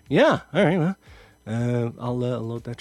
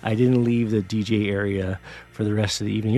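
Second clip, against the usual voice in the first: ordinary talk, nothing marked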